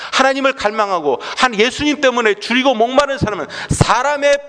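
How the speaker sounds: sustained high, driving sermon voice; fervent, insistent preaching; impassioned preaching. Real, not acted. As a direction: press each phrase on the congregation, pitch held high. Preaches at full force.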